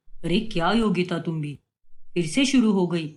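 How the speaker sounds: easygoing dad smoothing things over; lightly voiced father.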